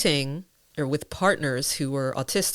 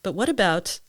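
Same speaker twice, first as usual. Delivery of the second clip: ordinary delivery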